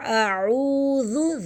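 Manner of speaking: slow chanted اعوذ with long oo and soft dh; soft dhal. Shown as a demonstration. Teacher modelling for students, اعوذ slowly with soft dhal. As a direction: draw out اعوذ, ending on a gentle short "dhu". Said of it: "ذال کو ہم نرمی سے پڑھیں گے".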